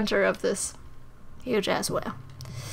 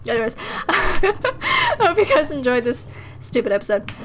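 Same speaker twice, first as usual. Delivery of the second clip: normal talking voice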